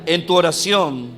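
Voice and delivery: loud, slow, pleading push to keep praying; fervent exhortation. Real, not acted. Preacher urging the congregation to keep praying, loud and slow. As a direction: drive each phrase home like a rallying call.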